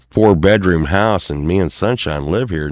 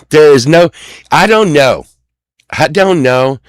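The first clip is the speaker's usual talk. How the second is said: loud, high, fed up; frustrated loud protest; exasperated outburst; 7 semitones higher, 7 dB louder than usual. Real, not acted. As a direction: throw up your hands and shout it.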